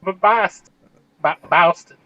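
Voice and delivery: fumbling, exaggerated Boston accent; halting stab at a Boston accent; attempted Boston accent. Shown as a demonstration. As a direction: try out a Boston accent, unsure. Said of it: "I don't know how to do it".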